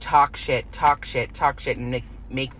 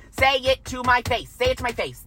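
higher, firm and deliberate demand; firm drill; 5 semitones higher than usual. Shown as a demonstration. Leading viewers in a practice chant, firm and higher. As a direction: model the line for viewers to repeat. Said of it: "Practice with me".

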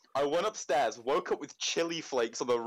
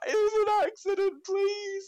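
very high strained squeak, a bit louder; desperate high plea; 4 dB louder, slower than usual. Retold or imitated. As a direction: beg in a tiny strangled voice. Pleading after being hit.

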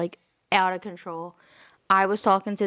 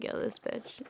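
whisper; 13 dB quieter than usual. Retold or imitated. Reacting to mom's call.

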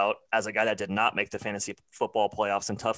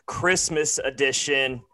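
announcer voice; 3 semitones higher, 4 dB louder, slower than usual. Acted. Hosted Christmas show intro.

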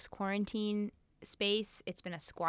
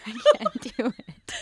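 laughing through it, louder; giving up between laughs; 4 semitones higher, 13 dB louder than usual. Real, not acted. Laughing at herself after failing to say southern.

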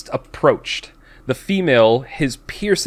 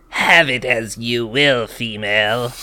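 villain voice; 3 semitones lower than usual. Acted. Priest's line read dramatically.

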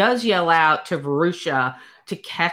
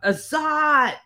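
retold scream; 9 semitones higher than usual. Red male screaming out from the forest floor, mock yell. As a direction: call the name out, held back. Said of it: "he screams out".

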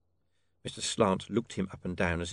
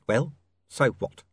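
dismissive; 4 semitones higher, 7 dB louder than usual. Acted. Pin snaps at Slant.

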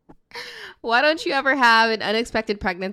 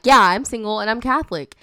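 eager, louder cab driver; excited; 2 semitones higher, 8 dB louder than usual. Retold or imitated. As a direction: blurt it out eagerly. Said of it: "gets excited".

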